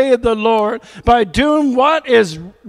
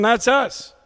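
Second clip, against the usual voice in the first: ordinary talk, nothing marked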